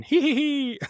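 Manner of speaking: high, mock maniacal tittering; maniacal giggle. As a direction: giggle like a madman. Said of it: "just a maniacal laugh".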